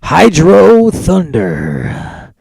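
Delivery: drawn-out, loud, high hype reveal; dramatic announcement. Real, not acted. Host unveiling his pick to Scott, big drawn-out hype. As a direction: announce the title like a game intro.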